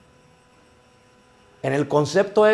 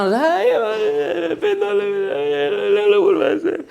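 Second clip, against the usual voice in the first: high-pitched hammed-up wailing, words blurred into sobs; mock crying; 13 semitones higher, slower than usual. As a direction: fake a tearful, unintelligible plea. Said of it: "si tú me sigues llorando y llorando".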